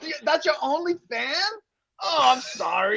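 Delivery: high, softening fake sympathy; mock pity. Pitying someone with only one fan, mock sympathy.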